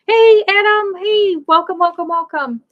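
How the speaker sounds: loud, high-pitched burst of greeting; excited welcome. Real, not acted. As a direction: hail a newcomer loudly, then ease down. Breaks off to greet arriving Adam.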